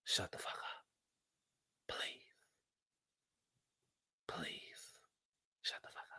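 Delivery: breathy whispered "shut the fuck up". Real, not acted. Telling Kyle to shut up, in a whisper. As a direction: hiss it under your breath.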